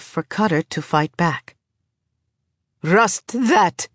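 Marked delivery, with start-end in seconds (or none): 2.8-3.9 higher-pitched angry growl. A narrator's reading. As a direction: growl it out as a curse. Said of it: "This is growled".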